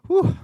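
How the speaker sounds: loud high excited whoop; excited whew. Real, not acted. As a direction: let out a burst of excitement. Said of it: "I'm excited".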